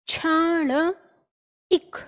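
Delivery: क्षणिक stretched very slowly, sound by sound; very slow dictation. Shown as a demonstration. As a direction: draw the word out for writing.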